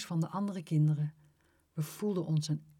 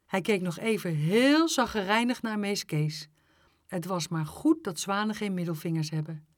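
ordinary delivery

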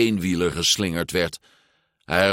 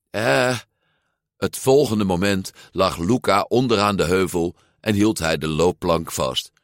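ordinary delivery

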